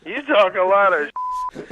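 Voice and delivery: drawn-out tough-guy threat. Real, not acted. Threatening the prank caller.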